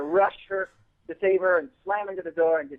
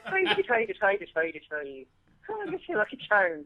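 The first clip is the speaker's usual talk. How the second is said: high, cartoonish Irish lilt; sing-song mock-Irish mascot voice; Lucky Charms Irish accent; 6 semitones higher than usual. Shown as a demonstration. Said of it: "the Lucky Charms accent".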